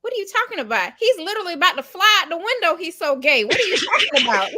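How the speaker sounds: high, loud mock disbelief; hammed-up exasperated protest; incredulous. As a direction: argue it as if it's absurdly obvious. Retold incredulous argument over friend.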